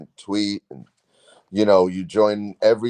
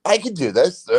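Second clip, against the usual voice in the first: high-pitched burst of confidence, lightly acted; self-convinced; 10 semitones higher than usual. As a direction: recall talking yourself into it. Retells his sudden burst of confidence.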